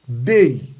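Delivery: "Dei" with loud E and weak I; E forte. Shown as a demonstration. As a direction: punch the E in "Dei", let the I glide. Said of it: "o E é forte, o I é não". ("Dei" com E forte e I fraco)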